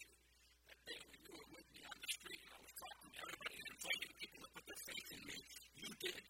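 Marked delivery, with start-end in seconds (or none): none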